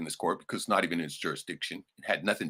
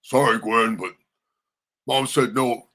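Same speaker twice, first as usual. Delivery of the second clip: louder, higher smug imitation; mocking brother; 5 semitones higher, 7 dB louder than usual. Mocking the brother telling his sister no. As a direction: smugly parrot the brother. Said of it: "he's like".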